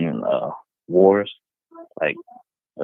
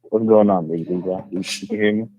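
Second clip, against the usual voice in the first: muffled phone voice through a wall. Real, not acted. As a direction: caller sounds muffled and far off. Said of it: "your voice muffled a, a, a little".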